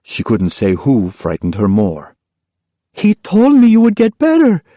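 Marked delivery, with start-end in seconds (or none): 2.9-4.6 put-on high, tearful child's voice; girl crying to her mother. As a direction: cry it out to mother. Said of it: "she cried".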